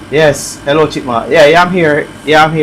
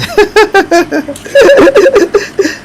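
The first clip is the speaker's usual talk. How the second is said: sudden high, squealing laugh; laughing hard at a chat joke about the dog; high laugh burst. Real, not acted.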